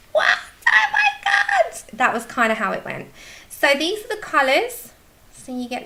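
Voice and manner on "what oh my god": very high, slow squeal of amazement; retold shock. Retelling unboxing the present to viewers, very high amazed squeal. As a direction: squeal it out, overwhelmed by the gift. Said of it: "I was like".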